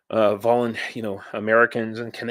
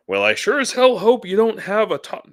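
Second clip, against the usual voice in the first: high, loud sneering commenter voice; mock troll voice; 12 semitones higher, 6 dB louder than usual. Vlogger reading a troll's message aloud, high mocking voice. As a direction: mimic the troll's smug message. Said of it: "this is my intonation".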